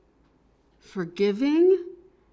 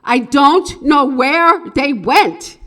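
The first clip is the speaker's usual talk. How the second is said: loud, slow and higher; stage voice call; 5 semitones higher, 10 dB louder than usual. Shown as a demonstration. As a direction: call it out for everyone to hear. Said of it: "Say these a couple of times".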